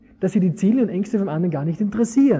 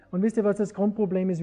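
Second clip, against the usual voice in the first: ordinary delivery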